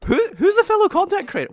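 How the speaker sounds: very high, loud incredulous question; feigned disbelief. Acted. Streamer denying he bullies fellow creators, feigned disbelief. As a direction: squeal it in mock innocence.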